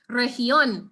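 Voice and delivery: "región" with an h sound: rehión; soft G. Shown as a demonstration. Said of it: "make sure you say región".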